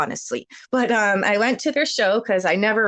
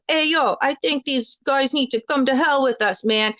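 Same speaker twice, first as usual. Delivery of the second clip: higher-pitched, badly imitated Jamaican accent; 5 semitones higher than usual. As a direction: mimic them in a clumsy Jamaican accent. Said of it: "really bad Jamaican accent".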